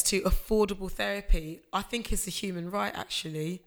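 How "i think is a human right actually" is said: normal talking voice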